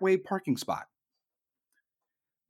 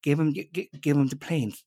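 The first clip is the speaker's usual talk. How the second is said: lower, halting stammer as Pelosi; 3 semitones lower than usual. Retold or imitated. Mocking Pelosi for the crowd, low and stammering. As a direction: trip over the words like a frail old politician. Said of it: "it's more like".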